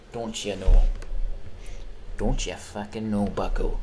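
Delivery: a touch of a mock Midwest accent. Streamer playing a Midwest guy, light Midwest accent. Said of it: "the Midwest accent".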